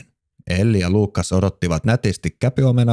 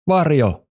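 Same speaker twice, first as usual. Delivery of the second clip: excited call; 8 semitones higher than usual. Acted. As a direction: call the answer out proudly. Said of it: "huudahti Luukas".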